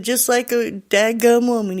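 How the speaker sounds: put-on Southern accent. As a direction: grumble lightly in imitated Southern speech. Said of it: "he has a really deep Southern accent".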